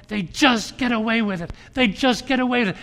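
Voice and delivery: loud and high, falling hard; loud indignant repeat; bitter outrage. Retold or imitated. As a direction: hammer the injustice. Friend's bitter complaint retold.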